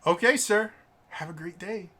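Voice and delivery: fake cheerful. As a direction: sweetly send him off. Clerk dismisses the stubborn customer.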